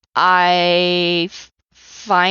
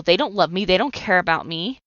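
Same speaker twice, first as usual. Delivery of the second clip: ordinary delivery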